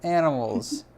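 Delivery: high, slow amazed gush; drawn-out awed delight; gushing over the cheese art. Real, not acted.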